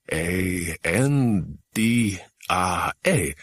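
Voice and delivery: slow, letter by letter; reading a faint carved name letter by letter; spelling out. A narrator's reading. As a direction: spell each letter deliberately. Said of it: "spelt it out".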